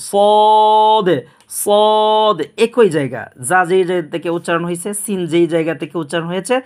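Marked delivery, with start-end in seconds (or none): 0.1-2.4 "সদ" held long, full and higher on one note; long, full-mouthed "সদ"; thick heavy sound. Shown as a demonstration. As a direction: intone "সদ" as a heavy sooood. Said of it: "মোটা আওয়াজে সদটা উচ্চারণ করবেন".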